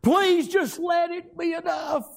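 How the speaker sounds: high, crying plea to God, breaking into sobs; sobbing prayer that fades to a hush at the end; weeping prayer. Retold or imitated. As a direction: cry out a desperate prayer, weeping. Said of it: "just weeping and crying".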